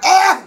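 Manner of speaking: sudden high, loud "ah"; retold yell. Teller imitating her outburst at the man in the elevator, mock yell.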